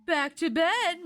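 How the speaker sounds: ordering. Acted. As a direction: order the children, drawing it out. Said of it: "Miss Powell ordered".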